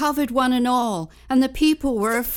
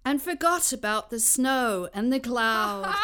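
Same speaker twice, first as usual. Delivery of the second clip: usual speaking voice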